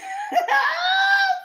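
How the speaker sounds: high, loud cackling shriek of laughter; loud high cackle. Real, not acted. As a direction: burst out in a shrill howl of laughter. Laughing at her own question.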